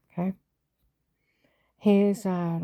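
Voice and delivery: ordinary talk, nothing marked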